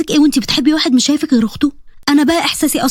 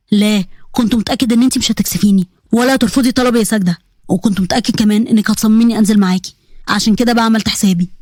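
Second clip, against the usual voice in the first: low voice, gently pleading; lower earnest uncle; 5 semitones lower than usual. Acted. Uncle asking his niece a favour, lower and earnest. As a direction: ask a favour like a loving uncle.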